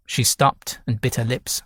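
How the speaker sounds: ordinary delivery, nothing marked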